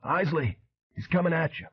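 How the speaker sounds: a failed yell that comes out a high, hoarse rasp. A narrator's reading. Flint warning Isley, hurt: strained hoarse rasp. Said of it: "a hoarse rasp emerged".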